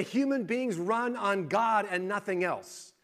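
ordinary delivery

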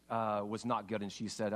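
ordinary talk, nothing marked

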